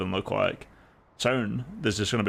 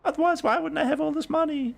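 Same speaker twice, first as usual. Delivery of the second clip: squeaky, much higher mock voice; mocking Erudite. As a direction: squeal it like a smug caricature. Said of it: "have Erudite come up and be like".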